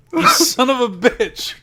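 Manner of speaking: loud, high, drawn-out cry of outrage; outraged shout. Real, not acted. Host yelling at the co-host who tricked him, outraged shout. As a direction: shout it slowly at the prankster. Outburst at co-host's prank.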